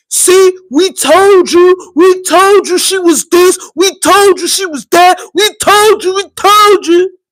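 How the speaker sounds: high, chanting mock "told you so"; loud gloating, imitated. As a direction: mimic noisy critics crowing in a repeated rising tune. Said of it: "everybody was loud as fuck".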